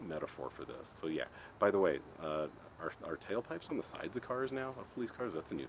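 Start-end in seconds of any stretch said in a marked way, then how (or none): none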